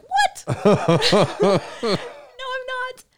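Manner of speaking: high squeak of laughing protest; laughing disbelief. Retold or imitated. Left in charge by the guard, laughing and protesting high. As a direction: laugh it off in shock.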